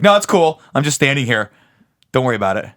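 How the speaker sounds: fake-cheery passive aggression; sarcastic. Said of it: "And you're like".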